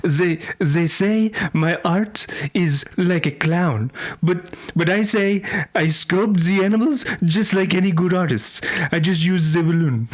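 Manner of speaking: put-on French accent. French balloon artist defends his art, thick French accent. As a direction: play an indignant French artiste. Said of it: "this is my Frenchman".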